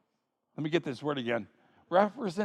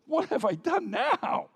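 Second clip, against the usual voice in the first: put-on high-pitched, put-upon kid brother; protesting little brother; 7 semitones higher than usual. Young David answering his angry oldest brother, exasperated. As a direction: protest the scolding in a raised young voice.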